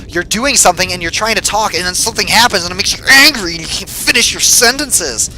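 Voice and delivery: rising into exasperation; pissed off. Real, not acted. Player explaining why talk breaks down, getting pissed off. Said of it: "I sound so pissed off right now".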